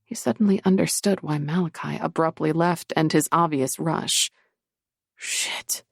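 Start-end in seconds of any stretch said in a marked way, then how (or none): 5.2-5.8 sharp whispered curse; hissed whisper. A narrator's reading. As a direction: hiss it under your breath. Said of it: "he hissed".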